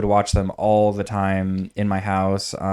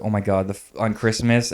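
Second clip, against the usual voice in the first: ordinary talk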